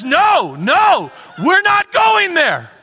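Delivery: loud laughing "no, we're not going there"; slow, loud, amused God pulling you back; God refusing. Acted. God to the wandering believer, loud and laughing. God voiced dragging sinner.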